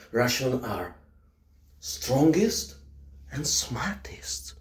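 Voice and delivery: slow hushed boast; whisper. Acted. Boasting Russians are smartest, whispered slowly. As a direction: whisper the punchline.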